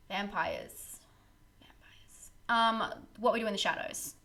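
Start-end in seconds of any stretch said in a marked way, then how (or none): 0.1-2.3 breathy whispered "Vampires." fading almost to nothing; spooky whisper. Pretend. Musing on vampire films for viewers, fading whisper. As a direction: let the word "Vampires." die away in a whisper.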